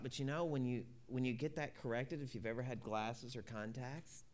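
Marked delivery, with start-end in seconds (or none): none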